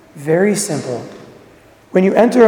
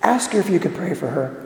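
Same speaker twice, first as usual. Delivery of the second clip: soft, hushed inner prompting; little voice; 3 semitones lower, 8 dB quieter than usual. Acted. Quiet voice prompting a shopper at the supermarket, soft. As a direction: say it softly, like a quiet nudge inside. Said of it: "You hear a little voice that says".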